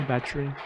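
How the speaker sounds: "Battery" as BA-tree; two-syllable "Battery", tried as a question; squeezed battery. Shown as a demonstration. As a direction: say "Battery" with no middle vowel. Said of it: "Pronunciation, man".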